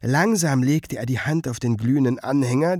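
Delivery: usual speaking voice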